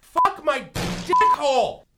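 ordinary delivery

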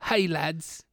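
lightly put-on British accent. Host trying out the listener's mail, mock British accent. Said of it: "read it all in a British accent".

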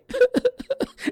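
very high falsetto sob-laugh; mock crying wail. Alien mother left crying, high mock wail. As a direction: wail like the grieving alien lady.

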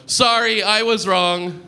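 lightly performed sudden "sorry"; acted apology. Sudden apology to screaming cyclist.

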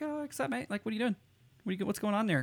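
high-pitched cheery mate greeting; friendly greeting. Retold or imitated. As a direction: pitch it way up, like hailing a pal. Acting out his friendly greeting.

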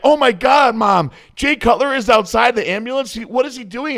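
starstruck excitement. Retold or imitated. Fainted bodybuilder hearing Jay Cutler is outside, excited squeal. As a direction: gasp it out like a starstruck kid spotting his hero. Learns his hero is outside.

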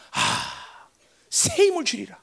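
sighing as God, then a solemn promise; lightly acted voice of God, opening on a breathy sigh; voicing God. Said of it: "쟤를 보는 순간 하나님 뭘 기억하겠어". (하나님을 흉내 내듯 한숨 섞어)

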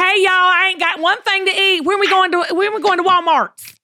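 mock rural Southern accent; country Southern drawl. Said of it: "I don't have the Southern drawl that's very, very educated".

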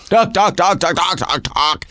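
mock yammering in rapid repeats; nonstop chatterer. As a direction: imitate a wife who won't stop talking. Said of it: "freaking out and not stopping talking".

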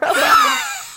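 high burst of laughter. Real, not acted. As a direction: laugh out at the memory. Laughs at shower memory.

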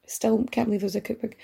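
usual speaking voice

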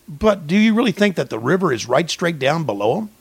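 normal talking voice

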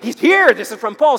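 high, loud eager announcement; excited messenger. Acted. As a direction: burst out with the big news. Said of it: "he's kind of excited".

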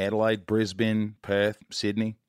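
city names listed in an Aussie accent; Australian accent. Shown as a demonstration. Host showing off to Australian guest, demonstrative Australian accent. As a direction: reel off the names with Australian vowels. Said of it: "learn an Australian accent".

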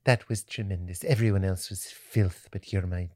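lightly voiced doting mom, soft; Missus Doubtfire. Mom praising her son after the show, lightly done doting voice. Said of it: "why she Missus Doubtfire".

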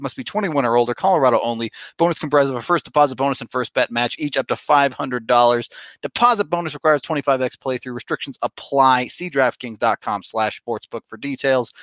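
quick, hurried terms and conditions; fast legal disclaimer. Real, not acted. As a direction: rattle off the fine print quickly.

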